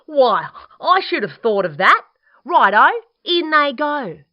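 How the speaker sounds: high, loud, excited exclamation; delighted dingo. Acted. Dingo welcoming Echidna's advice, excited and loud. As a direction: burst out with high, loud enthusiasm.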